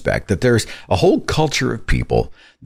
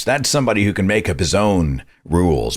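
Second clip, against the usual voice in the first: ordinary talk, nothing marked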